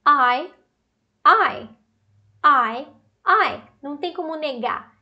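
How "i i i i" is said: "I" as AI, louder, each one isolated; demonstrated AI sound. Shown as a demonstration. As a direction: punch out each "I" with pauses between. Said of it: "Vamos olhar a transcrição?". ("I" como AI, forte e separado)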